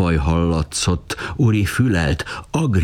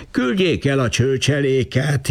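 much higher, whiny wail; 9 semitones higher than usual. A narrator's reading. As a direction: wail to be rid of the mob. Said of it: "jajdult fel a férfi".

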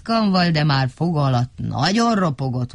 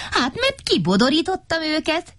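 high, proud claim; high-pitched little braggart; boastful character; 12 semitones higher than usual. A narrator's reading. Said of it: "csapott a mellérem Mikka Makka".

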